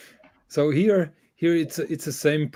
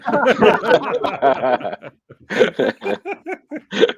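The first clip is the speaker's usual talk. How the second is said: long, high, loud belly laugh; real uncontrolled cackling laughter; 9 semitones higher, 7 dB louder than usual. Real, not acted. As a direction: burst out laughing helplessly. Laughing at the French line.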